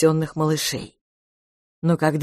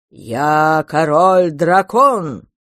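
loud, slow dinosaur roar; 6 dB louder, much slower than usual. A narrator's reading. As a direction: roar it out like a huge beast. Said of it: "проревел динозавр".

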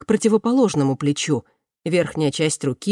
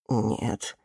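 put-on low, slow, soft old patient; quiet admission; 6 semitones lower, 5 dB quieter, slower than usual. A narrator's reading. Old man admitting pain to his doctor, quiet. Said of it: "тихо признался старик".

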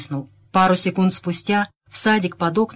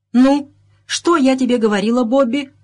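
higher, louder exclamation; a moderately excited I-told-you-so; exclaiming; 6 semitones higher, 5 dB louder than usual. A narrator's reading. Wife exclaiming to her husband, proved right, raised voice. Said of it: "воскликнула миссис Леннокс".

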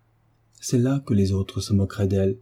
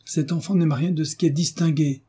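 exasperated lady's complaint, pitched up; exaspérée; 7 semitones higher than usual. A narrator's reading. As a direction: exclaim in exasperation, much higher. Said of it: "exaspérée, s'écriait". (exaspération aiguë, sans crier)

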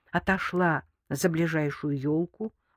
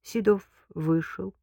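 ordinary delivery, nothing marked

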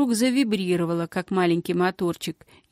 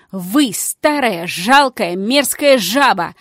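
insulting shout; 7 dB louder, slower than usual. Acted. Girl insults the crone.

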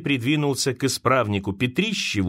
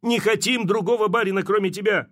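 crying out; 7 semitones higher than usual. A narrator's reading. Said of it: "кричал Гришка".

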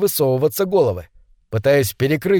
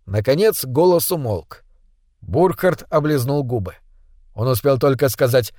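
plain reading voice

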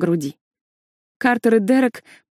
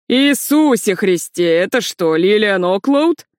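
loud slow drawn-out hail across a crowd; booming call; 3 dB louder, slower than usual. A narrator's reading. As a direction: call out loudly in surprise. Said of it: "громогласный оклик".

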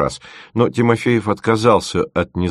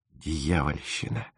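тихо и медленно, себе под нос; едва слышно буркни; пробормотал; 4 semitones lower, 12 dB quieter, slower than usual. A narrator's reading. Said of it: "пробормотал Тимофеев". (студент бормочет себе под нос, глядя на улов)

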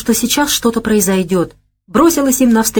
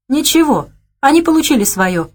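higher, with a light smirk; 7 semitones higher than usual. A narrator's reading. Natasha tells Savely the attackers got theirs, smirking. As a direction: brush it off with a grin. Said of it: "усмехнулась Наташа".

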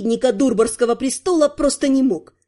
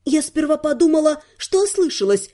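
ordinary delivery, nothing marked

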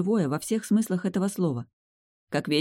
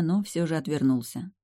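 ordinary delivery, nothing marked

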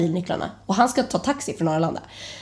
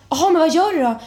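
panicky; 7 semitones higher, 4 dB louder, faster than usual. Retold or imitated. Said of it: "jag får ju panik".

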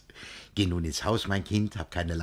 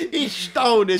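delighted laugh. Acted. Laughing delight at a grown boy.

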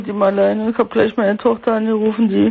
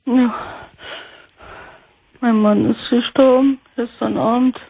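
sad; slower than usual. Real, not acted. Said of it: "du klingst aber traurig".

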